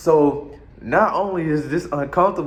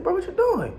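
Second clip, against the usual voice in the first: friend's question. Retold or imitated. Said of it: "He said, he said".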